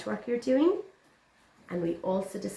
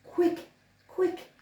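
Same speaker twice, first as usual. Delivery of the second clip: squeaky mouse voice; 12 semitones higher than usual. Acted. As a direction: squeak it out like a tiny scared mouse. Mouse urging.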